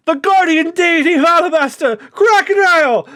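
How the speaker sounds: high, loud chant of praise; crowd cheering. Acted. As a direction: hail the desert hero. Crowd hails Crocodile.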